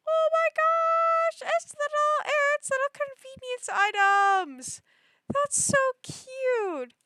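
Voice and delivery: gushing high-pitched excitement; excited squeal. Real, not acted. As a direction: squeal with delight at something tiny and adorable. Squealing over tiny cute items.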